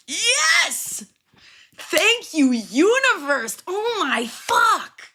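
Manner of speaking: high, loud shriek of delight, stretched out slowly; thrilled shout. Real, not acted. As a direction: scream it like you just won. Finds it is episode seven.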